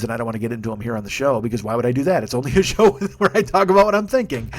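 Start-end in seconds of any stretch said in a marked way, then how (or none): 2.2-4.5 laughing self-mockery. Real, not acted. Self-mocking his own show.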